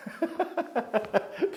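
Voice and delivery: high burst of giggling laughter; laughing. Real, not acted. Teacher cracking up at a student's expression, laughing.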